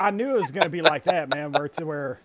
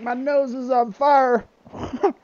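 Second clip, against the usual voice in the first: mock suffering; 6 semitones higher, 4 dB louder, slower than usual. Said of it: "he's like".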